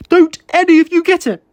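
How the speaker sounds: high, loud, desperate cry; begging the room to understand, voice cracking high; anguished outburst. A narrator's reading. David crying out to co-workers who feel nothing.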